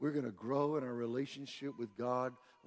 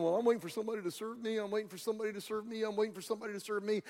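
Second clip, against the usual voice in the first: fast, higher-pitched repeated excuse; waiting complainer; 6 semitones higher, much faster than usual. Acted. Preacher mimics people sitting back waiting to be served, rapid-fire complaint. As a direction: rattle it off like a stuck record. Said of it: "You've been sitting back going".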